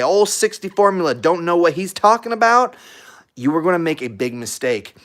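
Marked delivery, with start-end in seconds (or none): 0.0-2.8 high, louder mock scoff; dismissive owner. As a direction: brush off the advice in a raised sneering voice. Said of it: "if you say".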